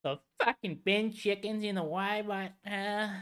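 ocker Australian. Retold or imitated. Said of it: "bogan voice continues".

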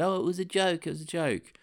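high, whiny mock excuse; a hint of a sheepish Obama. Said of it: "and then he said".

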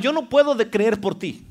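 ordinary talk

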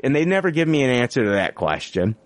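ordinary talk

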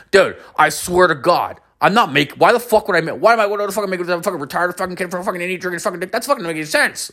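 high-pitched, racing defensive rant; acted-out exasperated friend tumbling over words; indignant protest. Friend protests he is not lying.

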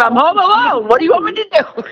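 high, mock-defensive reply; exasperated retort. A mother answers her son about her scary-looking dogs, exasperated. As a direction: toss it back at her son as if it were obvious. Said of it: "I'm like".

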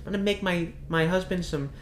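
lightly voiced gentle waitress; wholesome waitress. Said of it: "She's like".

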